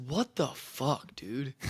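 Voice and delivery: slow, hushed, stunned muttering of disbelief. Retold or imitated. Quarterback reliving a backwards fumble, slow hushed disbelief. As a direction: let it fall out slowly, quietly, like you can't believe it.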